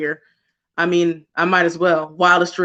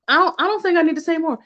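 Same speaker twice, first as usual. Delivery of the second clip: normal talking voice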